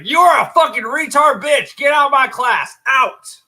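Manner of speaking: furious teacher screaming, high and loud; angry yelling. Retold or imitated.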